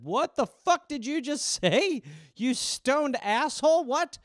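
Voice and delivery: flabbergasted and laughing in disbelief; shocked outburst. Retold or imitated. Son reacting to his aunt's cruel remark, stunned and incredulous.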